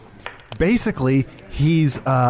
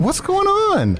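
catchphrase pitched way up; high inflection; slower than usual. Shown as a demonstration. Host trying the catchphrase when coached, high-pitched. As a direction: call out "What's going on?" high. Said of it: "Maybe a little higher inflection".

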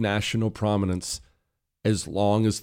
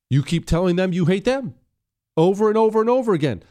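exasperated rant; 9 semitones higher, 5 dB louder, faster than usual. Real, not acted. Host ranting at Democrats.

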